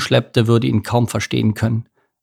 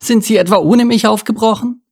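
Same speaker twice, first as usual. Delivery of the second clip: panicked; 12 semitones higher, 6 dB louder than usual. Acted. Little salmon fearing abandonment.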